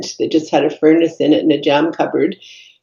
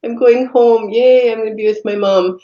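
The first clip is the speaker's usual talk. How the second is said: put-on reliving a little girl's joy at going home; imitating a five-year-old's delight, pitched up; thrilled child; 5 semitones higher than usual. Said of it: "And I was so thrilled".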